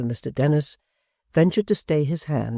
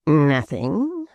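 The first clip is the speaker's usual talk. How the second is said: sneer; 2 semitones higher, 4 dB louder than usual. A narrator's reading. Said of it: "returned Gashford with a sneer".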